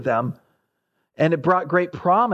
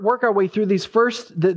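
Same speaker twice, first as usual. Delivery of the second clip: ordinary talk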